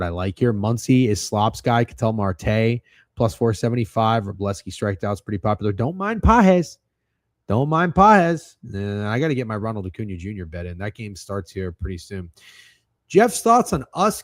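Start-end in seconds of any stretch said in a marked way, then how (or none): 5.7-8.6 slow, higher, louder singsong; slowed mock chant, said twice; teasing drawl. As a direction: stretch it out to needle him, "Don't mind Pages". Drawn-out teasing repeat.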